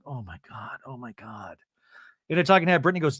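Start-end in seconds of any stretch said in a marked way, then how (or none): 0.0-1.6 low, much softer "oh my God"; torn hushed murmur. Retold or imitated. Brittany torn over Jared's texts, hushed murmur. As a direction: mutter it to yourself, anguished.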